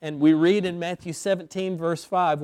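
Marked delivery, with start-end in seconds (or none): none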